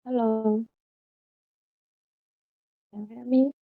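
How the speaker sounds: faint, distant mic voice; very low, hard to hear; barely audible. Real, not acted. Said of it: "But your voice is very low".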